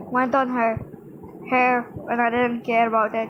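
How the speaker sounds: usual speaking voice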